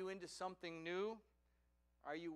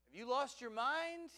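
higher voice, rising in disbelief; incredulous; 6 semitones higher, 4 dB louder than usual. Acted. Sarah hears they move nowhere known, incredulous. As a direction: question your husband's sanity.

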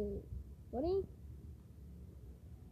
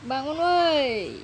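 drawn-out shout; 7 dB louder, much slower than usual. Acted. Waking someone up.

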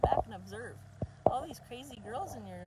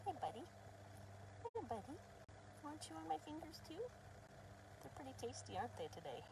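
put-on softer, higher baby talk to a puppy; talking to the puppy in a soft, higher cooing voice; 5 semitones higher, 5 dB quieter, faster than usual. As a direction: coo gently at the puppy.

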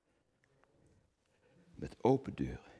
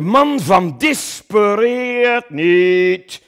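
booming slow proclamation, much higher and louder; grand declaiming shout; 16 dB louder, much slower than usual. Acted. Actor addressing the statue of Coen, declaiming hugely. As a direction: proclaim it to the crowd like a monument.

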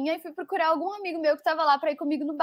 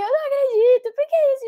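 high, louder anguished wail; wailing despair; 10 semitones higher, 6 dB louder than usual. Retold or imitated. Heartbroken at the bar, telling her friend, high tearful wail. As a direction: cry it out in disbelief. Quoting herself crying in despair.

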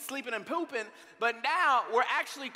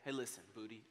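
tender dad; 13 semitones lower, 16 dB quieter than usual. Retold or imitated. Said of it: "I sit him down and I say".

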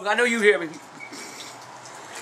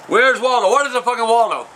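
normal talking voice

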